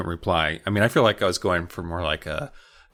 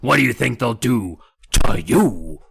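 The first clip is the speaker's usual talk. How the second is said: menacing dummy voice; 3 dB louder than usual. Acted. Dummy threatening.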